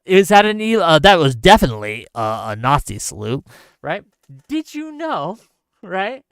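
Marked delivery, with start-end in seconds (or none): 0.1-3.4 mock arguing voice. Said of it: "arguing on Twitter about".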